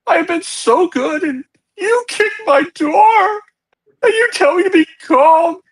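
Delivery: put-on high, loud, sobbing old man pleading; a terrified old man wailing through tears; old hermit's voice cracking as he cries and shouts; crying old man. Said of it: "old man voice and a guy crying like crazy".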